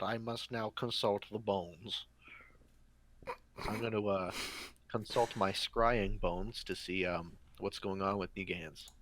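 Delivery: low, solemn seer voice; mystic seer. Acted. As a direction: speak like an oracle. Plays mystic character.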